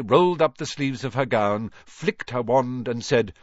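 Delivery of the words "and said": ordinary delivery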